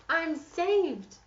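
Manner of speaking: high, loud, relieved princess cheer. Acted. Saved princess, high loud relieved cheer. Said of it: "cried the princess".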